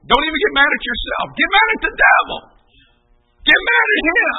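high, loud exhortation; rallying shout. Real, not acted. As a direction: urge them on at full cry. Preacher urges anger at the devil.

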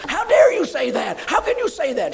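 sharp, high and loud, offended; indignant outburst. Retold or imitated. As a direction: fire back at the preacher, stung. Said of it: "She started crying".

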